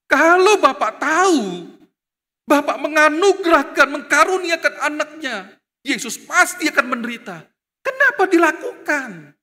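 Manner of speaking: high, loud rhetorical questioning; full-force preacher's challenge; impassioned preaching. Real, not acted.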